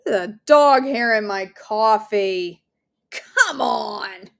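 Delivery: drawn-out grossed-out complaint; exasperated whine. Real, not acted. Host finding dog hair in her coffee, whiny exasperation. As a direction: whine it slowly in dismay.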